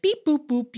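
high robot beeps and boops; playful mimicked robot beeping; little robot noises. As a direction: beep like a kid playing robot. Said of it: "making little robot noises".

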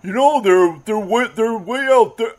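high, put-on busybody voice; mocking high imitation of a passer-by; pestering know-it-all. Mimics unwanted fishing adviser.

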